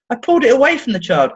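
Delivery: ordinary delivery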